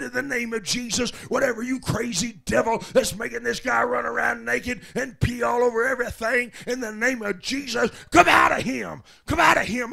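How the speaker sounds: loud preacher's rebuke, peaking on come out; full-voiced deliverance shout, rising to the end; shouted exorcism. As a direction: bellow the command at the devil. Casting out a devil, shouting.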